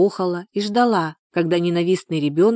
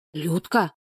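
hushed, shocked call of a name; a touch of appalled gasp; 3 dB quieter than usual. A narrator's reading. Neighbour shocked at the mother, mild gasp. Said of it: "ахнула Галина".

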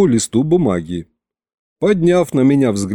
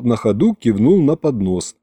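usual speaking voice

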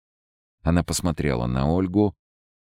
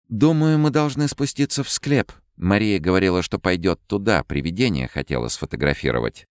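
higher, louder worried voice; anxious young woman; 6 semitones higher, 3 dB louder than usual. A narrator's reading. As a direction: urge them on, alarmed. Ольга встревоженно предлагает спуститься. (встревоженный женский голос)

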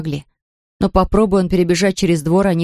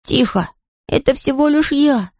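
rising high over the din; mild storybook shout; calling out loudly; 7 semitones higher than usual. A narrator's reading. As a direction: reassure the noisy hens, pitched up. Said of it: "закричал он как мог громко".

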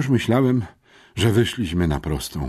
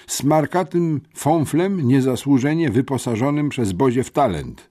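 huffing insult, higher and louder; 9 semitones higher, 5 dB louder than usual. A narrator's reading. Syfon huffs insults at Pucułka, indignant and loud. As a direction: puff it out in indignation. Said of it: "sapał Syfon".

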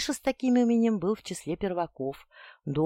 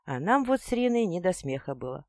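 plain reading voice

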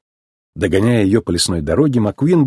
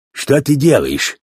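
slow, mildly indignant question; understated sheriff's outrage; 3 semitones higher, slower than usual. A narrator's reading. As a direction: demand an explanation, word by word. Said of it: "возмутился шериф".

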